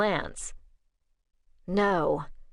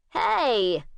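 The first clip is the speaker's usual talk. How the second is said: protest; 3 dB louder than usual. A narrator's reading. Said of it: "Eve protested".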